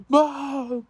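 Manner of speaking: high mock wail for mom; drawn-out, high-pitched imitation of crying; imitated crying. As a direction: imitate a tearful son calling out. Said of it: "he starts crying".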